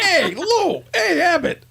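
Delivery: very high-pitched comic holler; Costello. Acted. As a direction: call out to your comedy partner in a squeal-high voice. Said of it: "I'm Costello".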